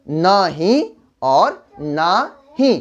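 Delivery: drawn out slowly, each word separate; slow careful demo. Shown as a demonstration. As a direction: spell it out slowly for the class. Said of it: "तब जाके नहीं".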